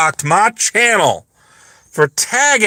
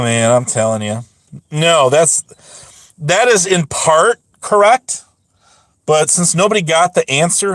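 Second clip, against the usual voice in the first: normal talking voice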